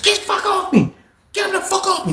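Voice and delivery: very high, strained mock yell; panicked yell. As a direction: scream to get him off you. Victim yelling under attack.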